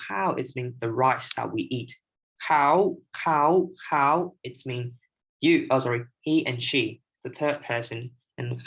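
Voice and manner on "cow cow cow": "Cow" with a rising Thai tone meaning he or she. Shown as a demonstration. Teacher showing Thai khao for third person, rising. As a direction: lift "cow" upward, Thai tone. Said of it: "pronounce the word K A O in five different tones".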